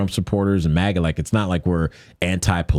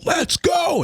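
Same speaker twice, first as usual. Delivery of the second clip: much higher shouted command; mock shouted push. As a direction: yell it like you're shoving someone forward. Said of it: "grabbing men from behind and going".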